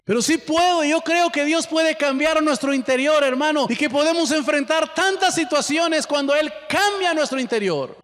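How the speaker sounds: loud, high fervent preaching; full-force sermon voice. Acted. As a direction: lift it up and proclaim it.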